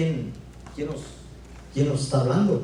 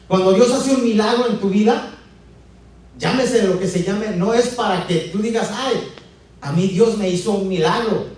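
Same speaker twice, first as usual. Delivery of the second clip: raised, louder sermon voice; emphatic preaching; 5 semitones higher, 6 dB louder than usual. Real, not acted. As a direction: drive the point home to the congregation. Preacher drives home the point.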